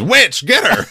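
high-pitched hammed-up call to the mob; mock accusing cry. Homer setting everyone on Lisa, mock accusing cry. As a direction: imitate someone rallying a witch hunt.

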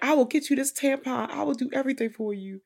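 high put-on voice, drawn up sweetly; over-helpful boyfriend impression. Podcaster imitating Ricky offering help, high over-helpful voice. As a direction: pitch it way up, eager to oblige.

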